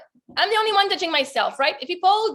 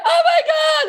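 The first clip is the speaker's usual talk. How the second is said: high delighted gasp through laughter; amazed laughing exclaim; 13 semitones higher, 7 dB louder than usual. Retold or imitated. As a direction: squeal with surprise and joy. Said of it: "I'm like".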